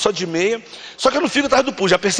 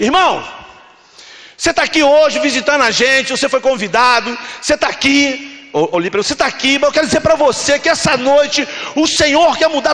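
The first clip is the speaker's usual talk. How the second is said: raised, impassioned preaching voice; full-force altar appeal; 4 semitones higher, 3 dB louder than usual. Retold or imitated. Preacher appealing to a visitor in church, impassioned preaching. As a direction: preach it out, stretching the final words.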